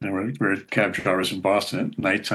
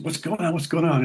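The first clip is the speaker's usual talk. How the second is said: higher, eager chatter; curious moms; 8 semitones higher than usual. Retold or imitated. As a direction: mimic the moms' eager curiosity. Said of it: "all the other moms go".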